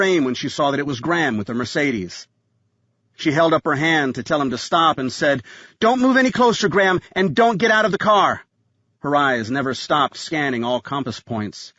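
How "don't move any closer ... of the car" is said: high-pitched urgent command; urgent warning. A narrator's reading. Jolene orders Graham back to the car, urgent warning. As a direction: warn firmly, pitched well up. Armed warning during a shootout.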